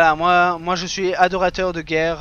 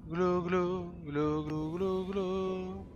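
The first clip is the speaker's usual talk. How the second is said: underwater distortion; 6 dB quieter, much slower than usual. Pretend. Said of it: "On parle sous l'eau".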